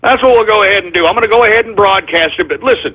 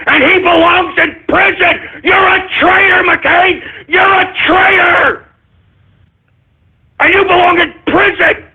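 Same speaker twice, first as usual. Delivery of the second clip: higher, yelling in full fury at McCain; raging shout at a traitor; furious shout; 10 semitones higher than usual. Real, not acted. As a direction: bellow the accusation like he's in front of you. Ranting that McCain belongs in prison.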